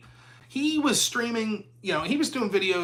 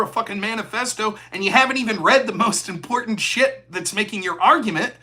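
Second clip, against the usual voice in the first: normal talking voice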